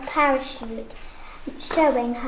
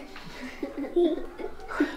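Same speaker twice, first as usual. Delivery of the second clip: giggle; 6 dB quieter than usual. Real, not acted. Embarrassed after asking teacher.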